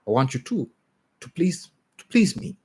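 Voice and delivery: pleading that shoots up into a squeak; the reader's overreaching first try at Rosemary's plea; rejected first take. A narrator's reading. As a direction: pitch climbing very high on please. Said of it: "I'll take that again".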